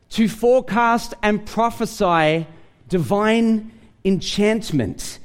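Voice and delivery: ordinary talk, nothing marked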